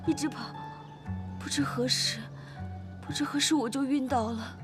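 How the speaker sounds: put-on very high, distressed girl's voice; frightened, tearful. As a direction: recount the terror to your father, close to tears. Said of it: "我好害怕".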